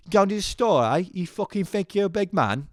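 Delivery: high, exaggerated mock Cockney; Cockney accent. Homies starting a chorus at a mate, mock Cockney. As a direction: taunt them in put-on Cockney. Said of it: "the Cockney accent".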